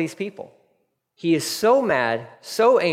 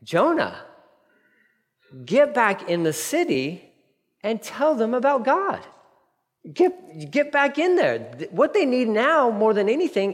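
rising higher and more urgent, ordering Jonah back; God urging Jonah back into the city, climbing in pitch; voicing God; 6 semitones higher than usual. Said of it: "it's like".

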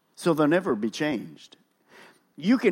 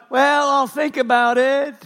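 mock reluctant demon; 8 semitones higher, 5 dB louder than usual. Demon stalling when ordered out, mock reluctant. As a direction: ham up a demon putting off the order. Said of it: "You don't notice the demon going".

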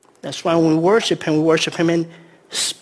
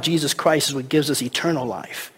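usual speaking voice

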